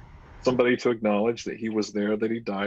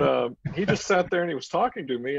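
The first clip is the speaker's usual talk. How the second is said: ordinary talk, nothing marked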